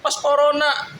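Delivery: high-pitched imitation of the wife, protesting; mimicking wife. Husband mimics wife accusing him before her father, high-pitched. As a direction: raise the voice high like his wife refusing. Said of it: "retold wife's reply".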